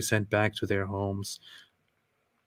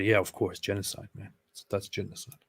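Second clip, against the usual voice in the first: deadpan, much softer mockery; sarcastic; 6 dB quieter than usual. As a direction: drop the voice and mock it. Sarcastic echo of critics.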